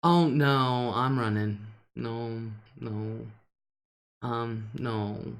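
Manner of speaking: usual speaking voice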